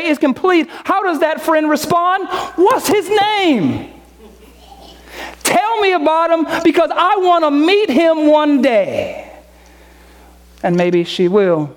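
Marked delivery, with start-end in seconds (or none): 2.6-9.3 put-on eager girlfriend. Friend on the phone asking his name, mildly higher.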